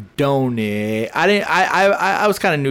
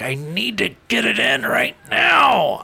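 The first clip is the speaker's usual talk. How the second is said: higher, pushy mock shout; mock urgent shout; 8 semitones higher than usual. Husband mocking his own urgency, mock shout. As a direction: blurt it like a desperate guy. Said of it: "where I'm like".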